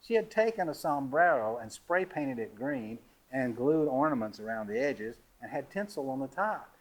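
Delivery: plain reading voice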